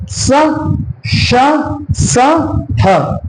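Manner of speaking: स, श, स, ह each hissed out separately; sounding out letters. Shown as a demonstration. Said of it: "इनका उच्चारण करें".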